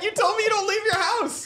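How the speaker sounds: high, teasing mock accusation; squeaky playful accusing imitation. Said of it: "you're like".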